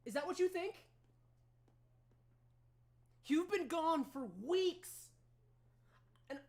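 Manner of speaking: high, loud, upset rebuke; angry mother. Acted. Queen rebuking her daughter after weeks of fear, loud and high. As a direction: snap back hurt and furious. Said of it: "She goes".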